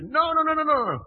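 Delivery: high loud panicked no no no; frantic denial. Acted. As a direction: deny it in a frantic yelp. Peter's panicked denial retold.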